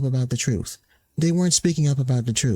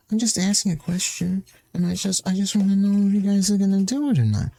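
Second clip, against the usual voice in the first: higher, put-on innocent little voice; mock innocent whine; 4 semitones higher than usual. Mocking banned players who play innocent, in a mock innocent voice. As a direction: play it sweet and blameless, mocking. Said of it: "making it sound like they were being all nice and innocent".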